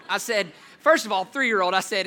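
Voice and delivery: usual speaking voice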